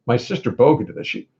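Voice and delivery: normal talking voice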